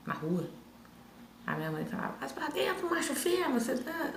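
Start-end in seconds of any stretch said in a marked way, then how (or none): 2.2-4.1 high-pitched mock scolding; scolding mother. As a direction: imitate mum ordering her child indoors, pitched high. Mother scolding child in story.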